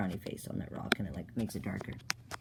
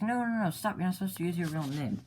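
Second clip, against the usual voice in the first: urgent correction; 7 semitones higher than usual. Acted. Clockwise scolded for using real name.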